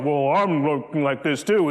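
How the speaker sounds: put-on deep voice, mimicking; mock-deep hero voice; Star-Lord copying Thor. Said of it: "Thor's got this real deep voice".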